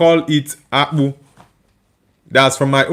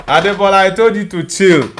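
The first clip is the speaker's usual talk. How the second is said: normal talking voice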